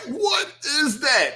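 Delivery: ordinary talk, nothing marked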